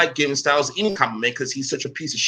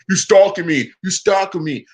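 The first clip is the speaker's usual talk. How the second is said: higher-pitched imitation of a complainer; mock whining; 4 semitones higher, 4 dB louder than usual. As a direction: whine it mockingly, like a repeated complaint. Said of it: "complain and bitch and whine and moan".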